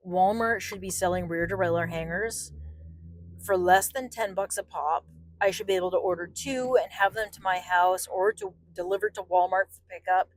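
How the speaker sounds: usual speaking voice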